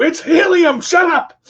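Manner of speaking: high, loud comic yell; mock shout. Snapping at a staring stranger, high loud mock shout. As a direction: bark it out high and loud as a joke. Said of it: "I would look at somebody and go".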